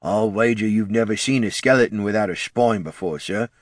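put-on character voice for a muttered aside; lightly muttered character grumble; muttering undead. A narrator's reading. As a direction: mutter a wry aside to a superior. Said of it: "muttered Neddard, leaning in close".

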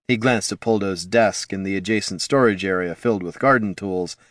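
usual speaking voice

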